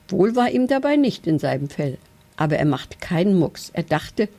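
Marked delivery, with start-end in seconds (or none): none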